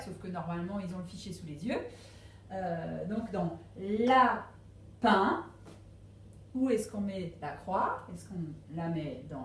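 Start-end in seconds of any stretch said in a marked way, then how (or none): 3.8-5.4 LAPIN drawn out as two slow beats, la... pin; syllable by syllable. Shown as a demonstration. Teacher helping pupils find the sound, LAPIN in slow separate syllables. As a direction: split LAPIN into "la", then a loud "pin". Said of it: "autant de cases qu'il y a de syllabes".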